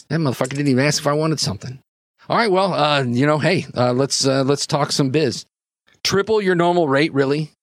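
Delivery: grumbling Jimmy, then businesslike. Acted. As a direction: grumble in character, then get down to the deal.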